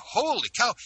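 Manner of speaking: high-pitched retold amazement; astonished reaction. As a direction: exclaim it high, re-enacting the astonishment. Retelling his astonished reaction.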